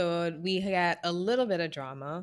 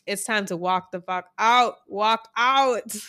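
emphatic urging; 4 dB louder than usual. Pretend. Urging Natalie to leave.

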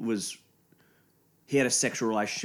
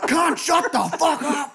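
high slow yell, much louder; retold shout; 8 dB louder, slower than usual. Yelling at the fifteen-year-old in the game, slow loud shout. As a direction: bellow it at the kid.

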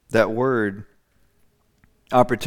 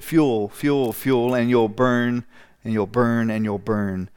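slow fading warning; slower than usual. Real, not acted. Preacher builds warning.

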